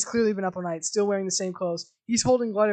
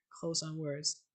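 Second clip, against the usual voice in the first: ordinary delivery, nothing marked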